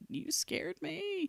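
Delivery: small, high, slow voice; soft mock-worried quote; imitated hushed fright. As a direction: say it softly and high, like a shaken onlooker. Quotes onlookers after her faint.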